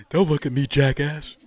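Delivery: high, loud sneer; mock wrestler taunt. Imagined wrestler riffing at the cops, loud jeer. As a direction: jeer it like a ring heel.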